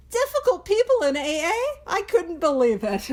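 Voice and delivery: high-pitched feigned shock; playful, exaggerated incredulous question; mock disbelief. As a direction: act scandalized, jumping up high then settling. Mock shock at next week's topic.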